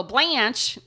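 BLANCHE with a loud Cleveland "a"; slipped Cleveland accent. Real, not acted. Host restarting the story, Cleveland accent on Blanche. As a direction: let Blanche slip out high and Cleveland. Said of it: "trying to really hard not to".

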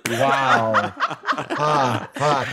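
normal talking voice

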